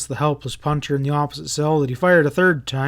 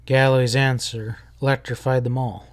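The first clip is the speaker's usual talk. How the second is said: ordinary delivery, nothing marked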